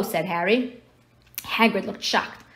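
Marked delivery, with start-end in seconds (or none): none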